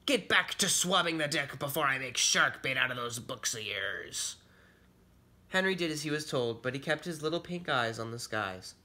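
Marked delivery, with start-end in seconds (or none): 0.1-4.4 pirate character voice, scolding order; threatening pirate captain. Acted. Captain threatens his son.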